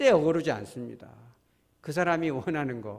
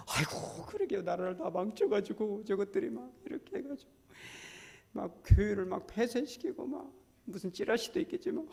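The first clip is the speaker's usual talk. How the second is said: much higher sighing voice of eager agreement; mock agreeing lament; 6 semitones higher, 4 dB quieter than usual. Pastor humoring angry congregants with a high, sighing mock agreement. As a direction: play along with their complaints, heaving a sigh. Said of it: "이러면".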